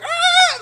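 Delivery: high loud roar of rage; angry yell. Retold or imitated. The insulted men yelling in rage as they chase. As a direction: shout like you're coming after them. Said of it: "they're like".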